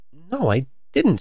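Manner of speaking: slow, high little answer; drawn-out innocent reply; surprised admission. A narrator's reading. Alice answers the Rabbit.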